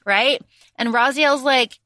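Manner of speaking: ordinary talk, nothing marked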